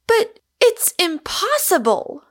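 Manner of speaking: shocked exclamation. A narrator's reading. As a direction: blurt it out in disbelief. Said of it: "ejaculated Rosamond".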